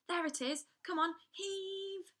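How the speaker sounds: loud, high, slow heave call; rally them to heave; urgent shout. Acted. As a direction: crow shouting for the door to be heaved open. Crow urges heaving.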